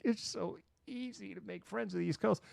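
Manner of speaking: high, soft incredulous squeak. Retold or imitated. East Coaster answering a lonely newcomer, mock incredulous.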